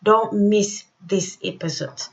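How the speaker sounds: normal talking voice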